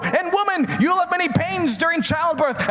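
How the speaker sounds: high-pitched, hammed-up divine wrath; angry God. God scolding Adam and Eve over the fruit, mock-furious high proclamation. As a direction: pronounce judgment as an outraged God. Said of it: "God showed up, and he's like".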